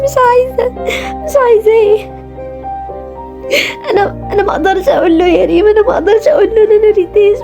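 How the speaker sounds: high, crying, pleading voice; tearful crying. Acted. Ritaj crying to Reem that she still loves him. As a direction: sob out the words, voice breaking. Ritaj sobbing confession to friend.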